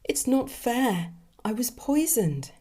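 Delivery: slow, high moaning plea. A narrator's reading. Dead woman moans to the Angel of Death. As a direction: whine it out like a cornered victim. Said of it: "she cowered, moaning".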